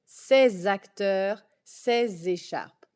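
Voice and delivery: ses acteurs prononcé sèz acteurs, lent; liaison with z. Shown as a demonstration. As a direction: appuyer le z de ses écharpes. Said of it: "with the sound z". (liaison lente en z)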